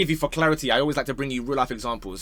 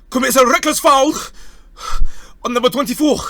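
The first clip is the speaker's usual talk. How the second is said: much louder and higher, formal stadium announcement; referee mic announcement; 9 semitones higher, 7 dB louder than usual. Retold or imitated. Mocking the referee announcing the VAR decision to the stadium, loud and formal. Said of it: "Then they'll get on the mic".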